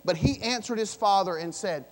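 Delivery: loud, building scripture read; preaching at full force. Acted. Pastor reading the angry son's reply, loud.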